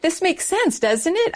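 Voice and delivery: high-pitched appeal, lightly imitating her younger self; earnest thirteen-year-old. Thirteen-year-old convert reasoning with family, earnest, lightly imitated. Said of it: "everything is very simplified when you're thirteen".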